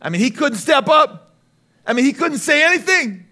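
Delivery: fast, high, loud preacher build; preaching at full force. Acted. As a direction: drive the point home to the congregation. Preaching peak on husbands.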